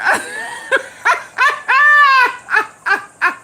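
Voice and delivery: high, long shrieking laugh bordering on a cry; long cackle. Real, not acted. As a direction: laugh till it squeals. Tipsy host cracking up at chat.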